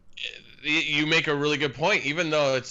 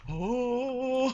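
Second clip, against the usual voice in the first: mock gasp; 11 semitones higher than usual. Fans gasp at announcement.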